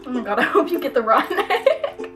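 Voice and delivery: normal talking voice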